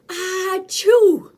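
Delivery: very loud, high achoo; enormous sneeze. Acted. Elephant giving an enormous sneeze, very loud.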